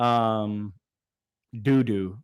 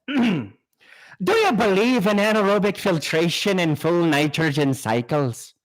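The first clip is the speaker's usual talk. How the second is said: mock Irish accent; 4 semitones higher than usual. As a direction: ask it in a hammy Irish accent. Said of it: "so Irish accent".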